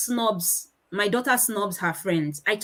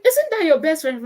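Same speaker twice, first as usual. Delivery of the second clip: higher-pitched questioning mum; prompting parent; 6 semitones higher, 4 dB louder than usual. Retold or imitated. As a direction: prod the child with a higher question. Acts parent prompting shy child.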